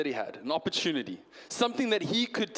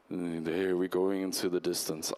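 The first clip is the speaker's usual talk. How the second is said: very low, slow voiceover impression; Morgan Freeman attempt; 13 semitones lower, slower than usual. Speaker trying Morgan Freeman's voice for the audience, very low impression. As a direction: attempt a famous deep narrator, drawn out low. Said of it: "I just don't sound like him".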